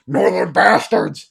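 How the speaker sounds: slow, loud, high yell; furious dying curse. Acted. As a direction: bellow it at the attackers, drawn out. Wounded man curses attackers.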